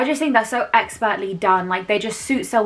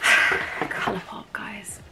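whispering; 6 dB quieter, much slower than usual. Real, not acted.

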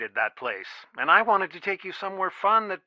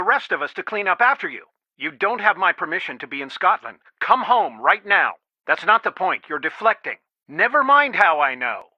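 raised, insistent parental reprimand; stern scolding; 3 semitones higher, 4 dB louder than usual. Acted. Father ordering daughter home from Scotland, stern scolding. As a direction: lay down the law to your child.

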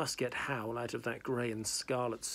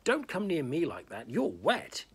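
hissed; 5 semitones higher, 4 dB louder than usual. Acted. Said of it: "he hissed".

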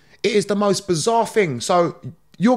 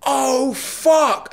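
high, drawn-out realisation; higher-pitched mock dismay; retold shock; 7 semitones higher than usual. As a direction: act out the penny dropping. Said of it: "And then I went".

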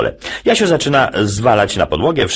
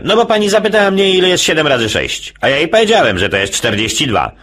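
put-on quick, higher boy's voice; fast high child voice; 9 semitones higher than usual. Jasiu explaining to dad, fast high child voice. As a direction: rattle it off like a kid.

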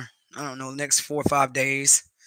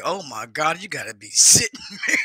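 retold shocked laugh; 7 dB louder than usual. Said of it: "I was like".

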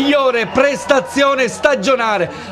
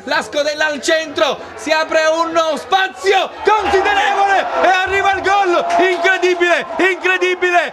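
breathless italian goal commentary; 4 semitones higher than usual. Real, not acted. Goal call in live commentary.

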